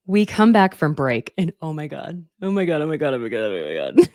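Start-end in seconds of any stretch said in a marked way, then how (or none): 2.4-4.1 oh my God through helpless laughter; cracking up, barely getting the words out; laughing fit. Real, not acted. Recapping the reunion to her fans, laughing helplessly.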